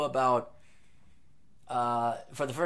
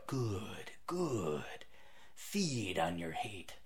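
low, soft villain whisper with a hushed second good; evil whisper; 8 semitones lower, 13 dB quieter, much slower than usual. Pretend. Podcaster mocks his listeners, hushed evil lord whisper. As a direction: hiss it out like a scheming dark lord.

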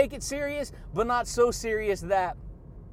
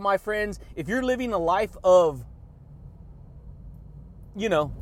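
ordinary delivery

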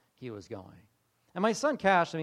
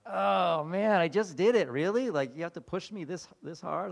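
loud groaning protest, easing off; mock exasperation; 3 semitones higher, 5 dB louder than usual. Man protesting God's prompting to go back, mock exasperation. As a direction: grumble back at being pushed. Acted grumbling back at God.

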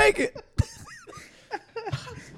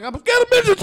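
high, loud mock-scared cry; mock panic; 10 semitones higher, 6 dB louder than usual. A boy being baptized cries out in mock fear. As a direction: ham up a child's fear. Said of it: "I was like".

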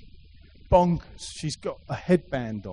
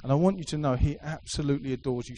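ordinary talk

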